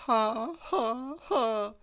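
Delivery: high, hollow forced ha ha; a sad attempt at laughing; doleful laugh. A narrator's reading. Miss Giggles tries to laugh, doleful. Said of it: "she said dolefully".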